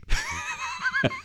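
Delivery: high, soft burst of laughter; real laugh. Real, not acted. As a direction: laugh softly at your own mishap. Laughs at his own mismatched clothes.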